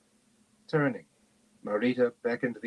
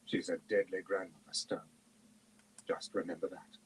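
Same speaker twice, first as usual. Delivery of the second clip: soft, low undertone; hushed warning; 4 semitones lower, 7 dB quieter than usual. A narrator's reading. Tanis warns Sturm near the sleeping dragon, hushed. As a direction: warn him quietly, dropping away. Hushed reply to whispering companion.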